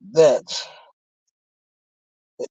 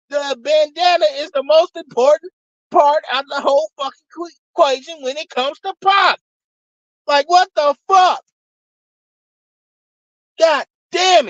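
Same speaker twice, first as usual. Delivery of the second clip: angry shouting; 9 semitones higher than usual. Real, not acted. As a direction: blow up at them, shouting every line. Furious rant at fifty and Stars.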